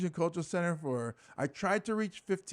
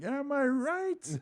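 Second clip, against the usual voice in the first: high, long drawn-out question; hammed-up stage hook; mock catchphrase; 11 semitones higher, much slower than usual. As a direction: stretch it out high, like a cheesy comic. Imagining the catchphrase at a big show.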